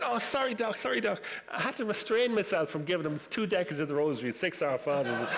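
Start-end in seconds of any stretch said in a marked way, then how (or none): 0.0-1.2 higher, sheepish sorry to the doctor; patient apologising to the Irish doctor, sheepish; embarrassed apology. Retold or imitated.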